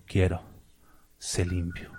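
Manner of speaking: low, soft and calm; tender Jesus. Retold or imitated. Jesus answers the kneeling leper, low and tender. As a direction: speak the healing gently and low.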